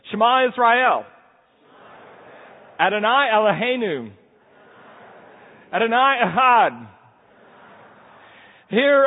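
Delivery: solemn congregational chant-like reading; liturgical recitation. Acted. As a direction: proclaim each phrase for the people to echo. Leads congregation in Shema recitation.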